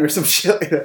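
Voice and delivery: ordinary talk, nothing marked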